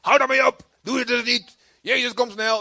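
high, loud hammed-up scolding; mock angry scold. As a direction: caricature an angry believer snapping. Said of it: "boos zitten te kijken". (spottend boos geschreeuwd)

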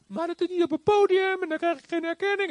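high, whining complaint; whiny complainer. Acted. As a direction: whine for recognition. Mocking complaining believer. (hoog en zeurderig, een gelovige die erkenning wil)

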